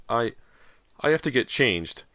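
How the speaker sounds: high, halting cry. A narrator's reading. Girl soaked in goo, crying out. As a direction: stammer it out, pitched high. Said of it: "Callie cried".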